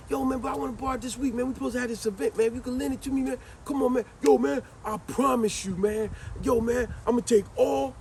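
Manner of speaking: high pleading, a bit fast; begging friend. Retold or imitated. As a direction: beg hard for the favor. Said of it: "he begged".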